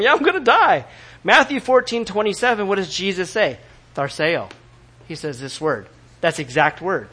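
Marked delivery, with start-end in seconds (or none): none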